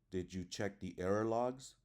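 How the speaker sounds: yes-no question climbing up to "logs"; rising intonation. Shown as a demonstration. Teacher reading a yes-no question example, rising intonation. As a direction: lift the pitch steadily to the end on "error logs". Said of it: "you can say yes or no questions".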